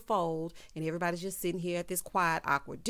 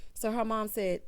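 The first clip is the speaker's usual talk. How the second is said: normal talking voice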